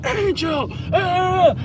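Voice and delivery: high, loud hammed-up yell of his name; mock yell. Cook yelling for him in the dining room, mock yell. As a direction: parody a cook bellowing from the kitchen. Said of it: "yell at me when I'm in the dining room like".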